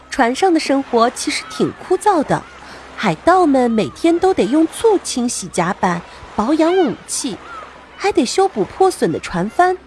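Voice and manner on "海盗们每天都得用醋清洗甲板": plain reading voice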